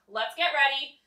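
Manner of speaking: normal talking voice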